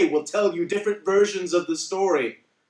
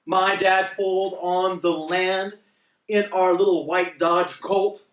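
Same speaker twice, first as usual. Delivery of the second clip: normal talking voice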